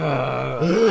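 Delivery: drawn-out groaning uh. Real, not acted. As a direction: groan out the sound on request. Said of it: "wanna go, "Uh"?".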